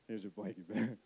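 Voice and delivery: low, very soft peace offering; sheepish dad. Retold or imitated. Dad returns daughter's blankie, retold sheepish quiet. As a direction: act out a chastened father handing it back.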